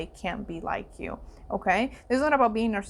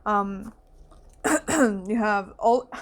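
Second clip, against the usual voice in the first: normal talking voice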